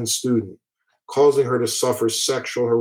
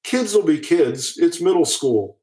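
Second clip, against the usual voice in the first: mocking dismissive quote; 6 semitones higher than usual. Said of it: "responded to taunts by saying".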